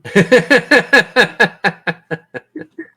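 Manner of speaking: loud amused cackle; high delighted cackle; high cackling laugh. Real, not acted. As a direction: laugh gleefully at the player. GM laughs at player's plight.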